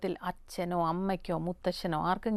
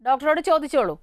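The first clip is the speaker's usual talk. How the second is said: raised, higher phone-in voice; calling out to caller; 5 semitones higher, 7 dB louder than usual. Real, not acted. Host urging a caller to ask the doctor, calling out. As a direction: project loudly down the phone line.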